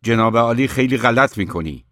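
furious outburst in a shop quarrel; angry shout. A narrator's reading. Aydin shouts at his brother Orhan over the lock, angry. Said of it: "آیدین فریاد زد".

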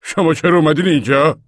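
loud, high harsh challenge; sharp and harsh. A narrator's reading. Old man challenges the mother found at the washtubs, harshly. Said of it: "مرد با لحنی تند و خشن گفت".

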